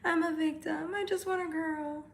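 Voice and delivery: mocking a viewer's self-pity; mocking whiny viewer. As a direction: whine it like a fake victim. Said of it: "Acting like".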